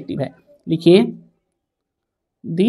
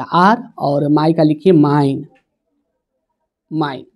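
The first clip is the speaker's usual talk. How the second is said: slow dictation; 2 semitones lower, much slower than usual. Shown as a demonstration. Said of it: "dictating to students".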